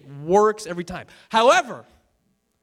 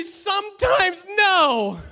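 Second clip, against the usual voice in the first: very high, drawn-out admission; hammed-up confession; 12 semitones higher, 4 dB louder, much slower than usual. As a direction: imitate an absurd confession at full pitch. Acted couple's confession, hammed up.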